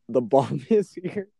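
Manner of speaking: mock dramatic laughing reveal; laughing announcement. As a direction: tell the kitchen the bomb is here, half-laughing. Announces the bomb to coworkers.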